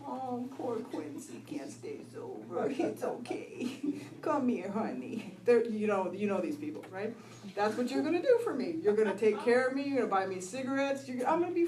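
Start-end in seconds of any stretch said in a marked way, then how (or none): 0.0-5.3 slow, soft, cooing comfort; mock coddling sympathy. Friends consoling the drunk who slipped, cooing pity. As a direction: coo over a drunk like a doting enabler. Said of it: "you guys know how to handle drunks. You'll be like".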